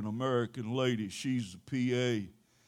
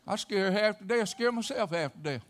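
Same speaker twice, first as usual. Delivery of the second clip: ordinary delivery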